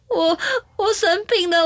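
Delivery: high, teary voice admitting being sick; tearful retold reply. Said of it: "我就哭了".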